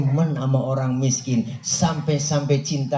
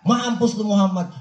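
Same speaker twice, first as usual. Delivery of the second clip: mock rude curse; 7 semitones higher than usual. The unbeliever translated into street talk, rude. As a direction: curse pitched higher. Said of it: "Kalau kita dikata begitu".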